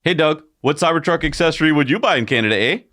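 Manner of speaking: mock Canadian accent. Host asks co-host about accessories, in a mock Canadian accent. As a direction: ham up a Canadian accent while asking a friend. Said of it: "not all Canadians talk like this".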